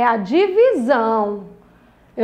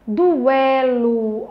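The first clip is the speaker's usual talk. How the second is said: slow, linked, stretched syllables. Shown as a demonstration. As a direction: draw out all three syllables. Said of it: "três sílabas".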